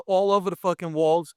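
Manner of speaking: ordinary delivery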